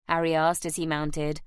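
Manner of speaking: ordinary delivery, nothing marked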